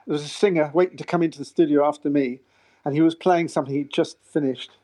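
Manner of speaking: ordinary delivery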